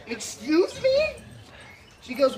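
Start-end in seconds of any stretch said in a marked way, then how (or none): 0.1-1.1 slow, much higher disbelieving question; high drawn-out excuse me; incredulous. Retold or imitated. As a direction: stretch it out in disbelief. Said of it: "And I said".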